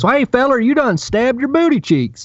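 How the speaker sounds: high-pitched, hammed-up Southern twang; ridiculous Southern accent. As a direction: imitate a cartoonish Southern good ol' boy. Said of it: "has this ridiculous Southern accent".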